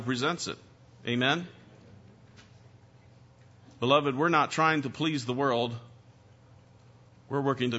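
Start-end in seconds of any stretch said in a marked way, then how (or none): none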